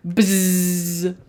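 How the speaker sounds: one long sustained buzz; bee buzzing. A narrator's reading. Bees keeping Owl awake, long steady buzzing. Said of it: "the bees buzzed".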